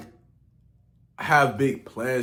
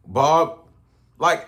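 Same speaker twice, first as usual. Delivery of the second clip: ordinary talk, nothing marked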